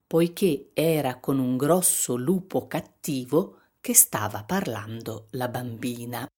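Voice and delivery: usual speaking voice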